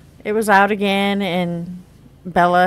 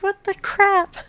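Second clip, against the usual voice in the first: shocked; 12 semitones higher than usual. Retold or imitated. Shocked at daughter undressed.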